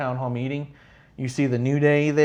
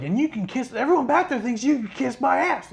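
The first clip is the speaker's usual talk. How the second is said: high, taunting imitation; pitched-up sneer; mock trash talk; 11 semitones higher than usual. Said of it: "where he's all like".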